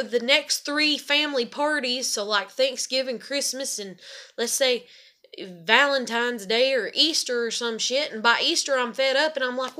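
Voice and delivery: ordinary talk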